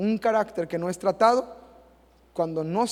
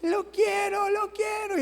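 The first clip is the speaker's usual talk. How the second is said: put-on much higher, whiny toddler demanding; crying child; slower than usual. Pastor imitating a toddler screaming for a toy, high. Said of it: "patalea y grita y".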